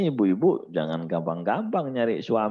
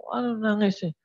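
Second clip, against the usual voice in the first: story figure; 12 semitones higher than usual. Acted. Dialogue in acted story.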